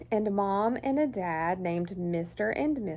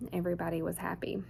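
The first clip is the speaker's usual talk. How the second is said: whisper; 4 semitones lower, 6 dB quieter, much faster than usual. A narrator's reading. Whispered quick aside.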